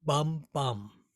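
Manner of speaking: "bum, bum" with two equal beats; even beats. Shown as a demonstration. Poet demonstrating even rhythm of "at times". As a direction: tap out "bum, bum" evenly. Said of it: "we would say".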